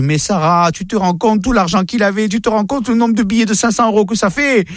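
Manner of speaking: reproche de mère, montant dans l'aigu; mère scandalisée. Acted. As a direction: sermonne ta fille, incrédule. (mère outrée, voix de plus en plus haute)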